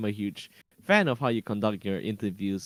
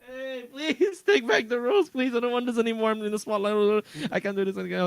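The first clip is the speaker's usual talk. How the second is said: high-pitched whiny begging; mock tearful pleading; 10 semitones higher than usual. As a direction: plead as if about to cry. Begging to swap roles back.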